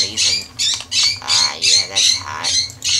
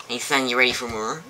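normal talking voice

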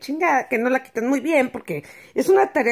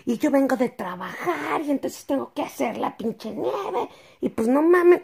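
higher, whiny mock neighbor; imitating neighbor complaining; 6 semitones higher than usual. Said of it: "la vecina de arriba me dice".

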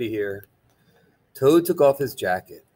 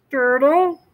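high, louder Toad; Toad's voice; 5 dB louder than usual. Acted. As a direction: call the turtle in a high voice. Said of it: "said Toad".

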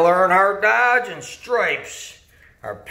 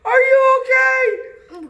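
much higher, yelled alarm; frantic announcer; 12 semitones higher, 3 dB louder, slower than usual. Acted. Announcer yelling as the referee gets hit again. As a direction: shout it like a shocked commentator. Excited race commentary.

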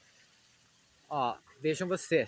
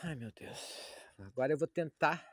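normal talking voice

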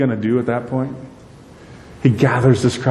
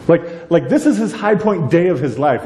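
ordinary talk, nothing marked

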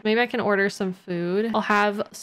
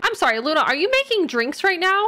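ordinary talk, nothing marked

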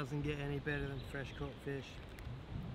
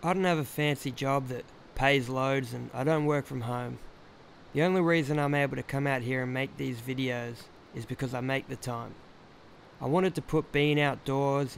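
louder, steady voiceover read; voiceover narration; 9 dB louder than usual. Acted. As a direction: deliver it like a sincere message to viewers. Recorded voiceover message to camera.

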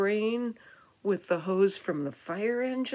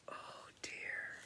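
whispered, very soft worry; soft breath of dismay; hushed dismay; 16 dB quieter than usual. Acted. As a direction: whisper it with concern. Worried reaction to the story.

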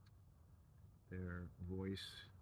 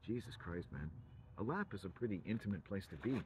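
ordinary talk, nothing marked

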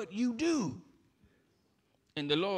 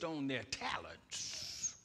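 whispered aside; 5 semitones lower, 13 dB quieter, slower than usual. Acted. Aside within list.

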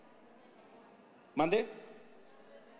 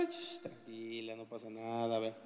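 hushed calming; 3 semitones lower, 8 dB quieter than usual. Retold or imitated. Retells himself calming wife.

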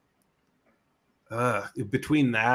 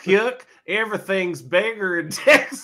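laughing tease; 8 semitones higher, 6 dB louder than usual. Pretend. Teasing Texas joke.

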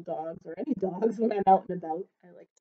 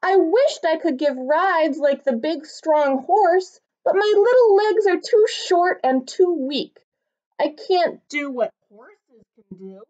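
higher, small wistful voice of a tiny dog; little dog Norbert, wistful; 7 semitones higher, 6 dB louder than usual. Acted. Tiny dog wishing he were big, higher and wistful. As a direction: sound small and longing.

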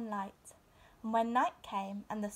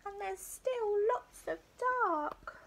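slow, high, small cub voice; a worried little cub, drawn out; little bear voice; 11 semitones higher, slower than usual. A narrator's reading.

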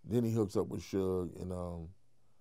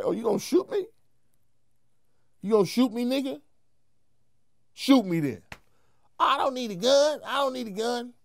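angry taunt; 13 semitones higher, 5 dB louder than usual. Retold or imitated. Daring a man holding a gun.

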